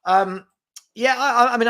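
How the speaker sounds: ordinary delivery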